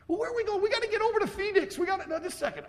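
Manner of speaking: high, hammed-up spouse asking questions; playful high family voices in the car; mock spouse voice. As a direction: squeak it out like a puzzled wife.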